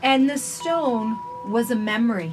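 gentle, measured storybook reading; reading to children. Acted. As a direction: read it slowly to little listeners. Reads picture book aloud.

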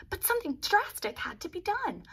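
plain reading voice

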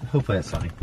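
usual speaking voice

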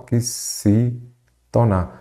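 oxítona slowly broken into separate syllables. Shown as a demonstration. Teacher counting out syllables for students, oxítona slow and segmented.

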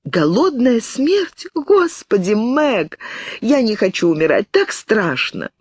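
put-on high, trembling plea of a frightened girl; high-pitched terrified girl about to cry; terrified sobbing girl. A narrator's reading. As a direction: beg as if sobbing in panic. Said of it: "Она рыдала".

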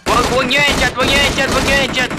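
high urgent pleading; urgent plea. Real, not acted.

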